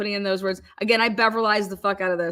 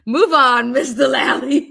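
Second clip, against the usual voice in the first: louder, higher mock scolding of the lawyer; mock judge; 4 semitones higher, 7 dB louder than usual. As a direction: wave the lawyer along in a loud mock judge voice. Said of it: "judge scolding the lawyer".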